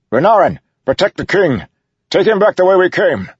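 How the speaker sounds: loud, high battle command; shouted orders. A narrator's reading. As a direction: bellow urgent orders over the danger. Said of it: "Dalinar shouted".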